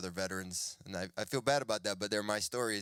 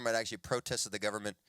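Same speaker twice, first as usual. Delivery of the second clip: ordinary delivery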